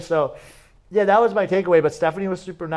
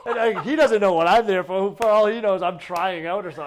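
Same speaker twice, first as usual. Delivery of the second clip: amused, still laughing at it; laughing while telling; 5 semitones higher, 5 dB louder than usual. Real, not acted. Telling a podcast host the story, laughing. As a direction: talk through a laugh, higher and louder.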